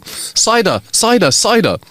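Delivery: high-pitched mock yell; shouting child. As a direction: call out urgently like a schoolboy. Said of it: "He runs to his teacher shouting".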